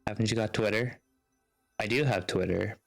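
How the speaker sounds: ordinary talk, nothing marked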